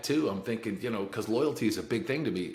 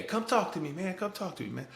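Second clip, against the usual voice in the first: high, coaxing call to the cell across the hall; 9 semitones higher than usual. Retold or imitated. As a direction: keep coaxing him to come talk. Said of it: "Every day".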